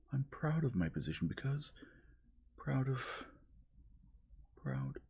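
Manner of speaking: high, breaking into tears; voice choked by tears. A narrator's reading. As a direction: falter as tears stifle her. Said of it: "Tears of shame and despair stifled her voice".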